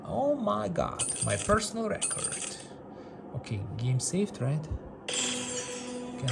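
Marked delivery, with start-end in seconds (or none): none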